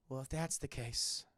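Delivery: slow whispered threat; sultry whisper. Acted. Jade rejected by Liu Kang, slow menacing whisper. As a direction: whisper it, calm before the snap. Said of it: "She goes from, from fucking to".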